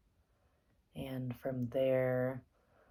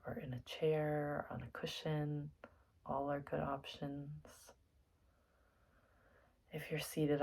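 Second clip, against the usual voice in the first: soft, unhurried meditation guide with long pauses; guided meditation; 3 dB quieter than usual. Acted. Teacher settling listeners into their seats for meditation, soft and calm. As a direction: gently talk them into stillness, leaving space between phrases.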